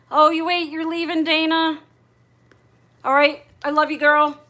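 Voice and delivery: high, loud call after a friend leaving. Real, not acted. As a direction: shout it after her across the room. Calling out to leaving Dana.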